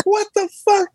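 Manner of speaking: high-pitched incredulous outburst, half laughing; amused, high-pitched astonishment; retold shock. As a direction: retell it in stunned, squeaky disbelief. Retold shock at fallen teeth.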